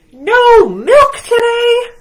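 very high, loud dismayed cry; anguished cry. Acted. Farmer hearing there's no milk, loud dismayed cry. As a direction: wail at the news like it's a disaster. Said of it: "cried the farmer".

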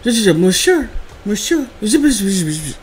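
mock French babble, repeated je; fake French gibberish. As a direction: play at sounding French. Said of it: "She's like".

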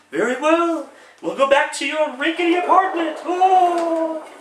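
lofty falsetto spirit; high ghost voice. Acted. Ghost announces departure.

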